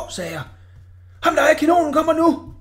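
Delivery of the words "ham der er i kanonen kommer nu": loud, much higher mocking roar with a laugh. A narrator's reading. Orla roars back at the boy's warning, laughing. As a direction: bellow it out laughing. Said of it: "brølede Orla".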